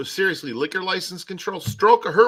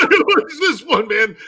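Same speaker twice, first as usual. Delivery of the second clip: laughing; 9 semitones higher, 7 dB louder than usual. Real, not acted. Laughing at a gesture.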